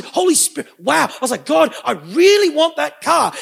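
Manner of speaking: high, loud mock pleading; eager longing. Preacher retells asking God for a car, eagerly excited. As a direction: gush with longing, leaning hard on "really".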